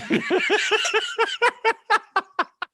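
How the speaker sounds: high laughter. Real, not acted. Laughs at own story.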